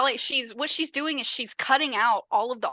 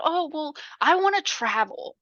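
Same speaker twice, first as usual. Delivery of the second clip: dreamy mock whine; 6 semitones higher than usual. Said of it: "goes off on this rant".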